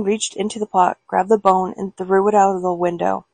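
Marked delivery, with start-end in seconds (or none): none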